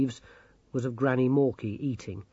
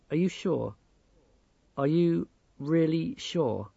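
hesitant question, slowing as it repeats; wary, deliberate warning; pointed, careful tone; 3 semitones higher than usual. A narrator's reading. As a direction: ask carefully, drawing out the second question. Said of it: "The tone of his voice".

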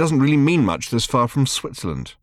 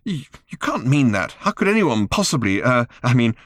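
put-on bitter girl; 12 semitones higher than usual. A narrator's reading. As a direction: pour out your hurt angrily. Random bitter about mother.

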